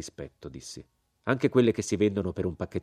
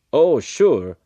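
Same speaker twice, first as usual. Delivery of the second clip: loud, higher-pitched exclamation; exclaimed agreement; 7 semitones higher, 11 dB louder than usual. A narrator's reading. Colonel chiming in to back the general, exclaiming. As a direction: burst out in emphatic agreement.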